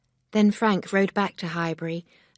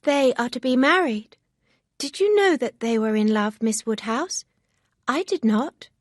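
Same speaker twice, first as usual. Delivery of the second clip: higher, lightly voiced young girl; a hint of an eager young friend; Harriet, lightly voiced, eager; 7 semitones higher, 6 dB louder than usual. A narrator's reading. Harriet's voice in read story.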